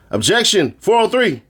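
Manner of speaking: high-pitched mock lawyer objection; courtroom objection. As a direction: pipe up with a high, acted objection.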